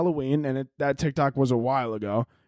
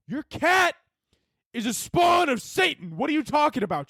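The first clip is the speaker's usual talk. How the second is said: very high, shouted protest; outraged disbelief; 12 semitones higher than usual. Acted. Confronting Deborah about her undead cat, mock outrage.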